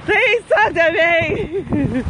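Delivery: very slow, stretched whiny plea; drawn-out whine. Pretend. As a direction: drag it out like a playful whine. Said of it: "I need some encouragement".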